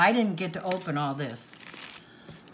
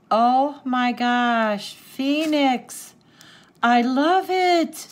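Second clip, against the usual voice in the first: loud, high, drawn-out delight; real thrilled exclamation; overjoyed; 5 semitones higher, 9 dB louder, much slower than usual. Real, not acted. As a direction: gush it out loud and slow. Thrilled at the beautiful gift.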